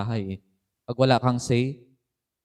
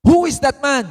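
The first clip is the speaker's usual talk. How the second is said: a high, loud outraged roar; furious king's shout; 11 semitones higher, 10 dB louder than usual. Acted. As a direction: bellow it like a king demanding the culprit. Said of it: "Galit na galit siya".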